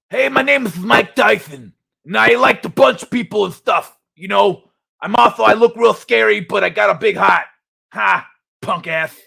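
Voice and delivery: loud, lisping boxer impression; Mike Tyson. Streamer imitates a boxer who can't say his S right, lisping. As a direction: soften every S into TH, pushed loud. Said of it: "I'm gonna try to do my impression of him".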